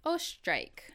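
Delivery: usual speaking voice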